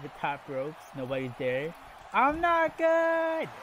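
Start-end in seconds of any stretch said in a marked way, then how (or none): 2.1-3.5 high, stretched-out pretend scream; hammed-up wail, held long; mock scream. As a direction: yell it slowly and high, imitating a wrestler. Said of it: "and just screams".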